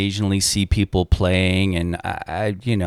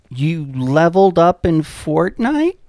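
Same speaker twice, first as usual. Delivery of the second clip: imitating the mom; 6 semitones higher, slower than usual. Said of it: "their mother's like".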